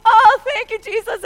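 loud, high burst of relieved praise; acted-out outburst of relief; praising. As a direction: cry out thanks as if just rescued. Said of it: "I praise the Lord".

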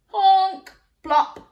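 high, loud honk then plop; goose honk and plop. A narrator's reading. Goose honking as it lays.

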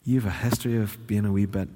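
soft, low, insinuating voice; hushed, low coaxing with lingering pauses; subtle tempter. Acted. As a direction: plant doubts gently, posing as reasonable counsel. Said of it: "Instead, it's a bit more like".

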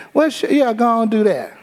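high, light indulgent mom voice; mock mom, way up high and breezy; mom's easy yes.

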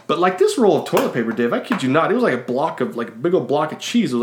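ordinary delivery

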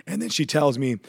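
ordinary delivery

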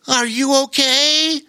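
high-pitched otter calling up. A narrator's reading. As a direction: call up in a high character voice. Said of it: "Calling up to the young boy".

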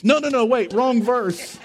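high, loud mock alarm; comic panic. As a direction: backpedal in hammed-up panic. Acts alarm at unwelcome verse.

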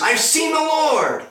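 high, slow joyful shout; exultant announcement. Acted. Mary to the disciples with her news, exultant shout. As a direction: proclaim it out loud, drawn out.

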